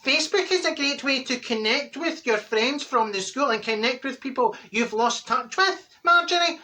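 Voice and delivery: put-on high earnest young lad voice; young man Josh. Young Josh lecturing Marjorie about Facebook, high and earnest. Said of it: "Josh says to me".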